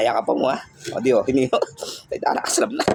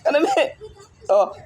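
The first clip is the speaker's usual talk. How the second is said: Kanan lifted much higher, men rising; high coaxing; 13 semitones higher than usual. Real, not acted. Vlogger asking the pet again for the right paw, high coaxing.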